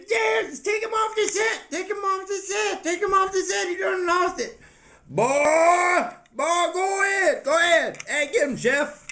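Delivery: high-pitched frantic demands; hysterical outraged character. Acted. Castmate demanding Cookie be taken off the set, frantic.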